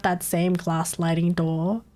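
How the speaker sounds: ordinary talk, nothing marked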